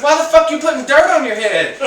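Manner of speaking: loud, high, baffled retort; baffled yell. Retold or imitated. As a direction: shout it like it makes no sense. Confused retort to the coworker.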